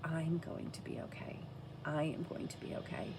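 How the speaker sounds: softly telling yourself you'll be fine; quiet self-reassurance. Retold or imitated. Recovering mother telling herself she'll survive, softly. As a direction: murmur it like a promise to yourself. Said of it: "Then I was like".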